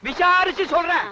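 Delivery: high-pitched, testy reply; exasperated shouting. Acted. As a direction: raise the pitch and brush them off. Husband loudly puts off wife's question.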